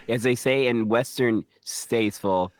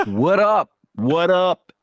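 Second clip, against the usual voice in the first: loud, high playful greeting; 4 semitones higher, 6 dB louder, much slower than usual. Real, not acted. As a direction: call out cheerfully.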